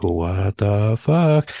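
very slow, drawn-out bafflement; stunned, long-drawn reenactment; retold stunned disbelief. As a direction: stretch each word out in disbelief. Retells seeing figure gone.

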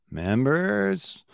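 "Members" swept upward like a question; imitating rising uptalk. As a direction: stretch "Members" and glide high at the end. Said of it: "it's the way his voice goes up".

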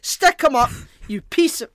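high, loud hammed-up mugger; mock robber. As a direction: demand the cash like a bandit.

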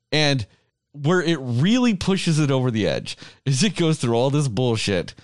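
ordinary talk

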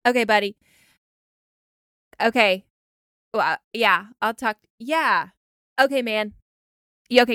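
mock the endless okays before hanging up; imitating boyfriend on phone. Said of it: "he'll be like".

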